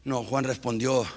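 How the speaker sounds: low, soft, near whisper; hushed close. Acted. Preacher closes softly, almost whispering to the church. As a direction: breathe the words to them.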